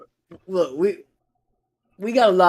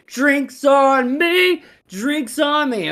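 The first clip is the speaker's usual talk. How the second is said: high, loud, long-drawn-out holler; slow, pitched-up crowing boast; big barroom announcement; 9 semitones higher, 6 dB louder, much slower than usual. Acted. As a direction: call it out to the whole bar, stretching each word. Same man, same announcement.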